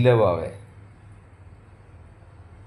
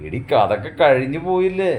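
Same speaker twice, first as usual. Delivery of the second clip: higher, louder reproach; scolding; 6 semitones higher, 6 dB louder than usual. Acted. Husband scolding his crying wife to forget the past, loud. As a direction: scold her to let it go.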